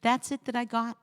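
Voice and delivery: usual speaking voice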